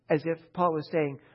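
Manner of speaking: usual speaking voice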